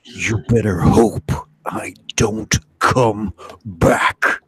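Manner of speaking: raspy whispered tough guy; Snake Plissken voice. Acted. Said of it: "do the Snake voice".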